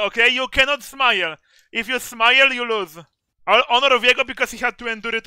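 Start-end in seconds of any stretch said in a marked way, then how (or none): none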